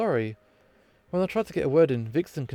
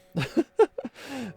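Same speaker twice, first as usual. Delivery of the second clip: shrill little cackle; high mocking giggle; 11 semitones higher than usual. Vixen giggling at Alice after her rhyme. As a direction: giggle high, pleased with herself.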